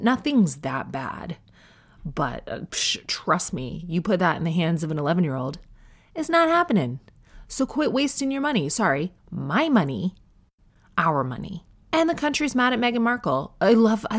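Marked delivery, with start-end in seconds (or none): 6.1-8.7 exasperated. Real, not acted. Mock exasperated dismissal.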